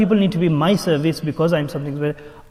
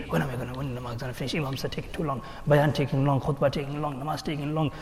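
impatient grumbling; 8 dB quieter than usual. Acted. Restless worshipper complains the imam takes too long, muttered grumble. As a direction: grumble it under your breath.

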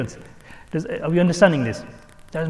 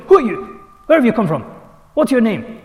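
stern, loud, higher-pitched challenge; very strict; 7 semitones higher, 6 dB louder than usual. Retold or imitated. Strict scholar questioning a newcomer, stern and loud. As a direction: imitate a strict elder interrogating a visitor. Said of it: "he's very strict".

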